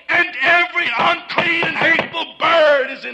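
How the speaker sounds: loud, rising condemnation, each line hammered; preaching at full force. Real, not acted. Preacher condemning America to his congregation, full-force shouting. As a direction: thunder each charge from the pulpit.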